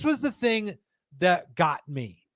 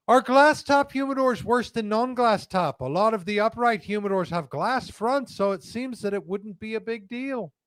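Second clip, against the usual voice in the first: Jordan Peterson-ish reading; 6 semitones higher than usual. Acted. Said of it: "That almost sounded like Jordan Peterson".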